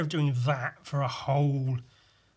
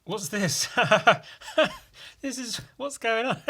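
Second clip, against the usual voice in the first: high laughing bafflement; giggling mock confusion; laughing friends; 8 semitones higher than usual. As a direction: laugh through the question like a puzzled friend. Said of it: "it was exactly like".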